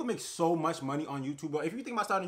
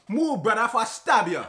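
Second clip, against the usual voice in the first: mock English gangster; 7 semitones higher, 8 dB louder than usual. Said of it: "when they wanna sound gangster and mad".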